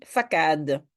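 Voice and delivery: "facade" said with a hard K, fa-KADE; wrong pronunciation. Shown as a demonstration. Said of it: "sinon je dis facade".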